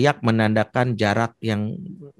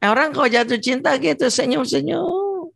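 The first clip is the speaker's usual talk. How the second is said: giddy in love; 12 semitones higher than usual. Retold or imitated. Acting giddy lovers.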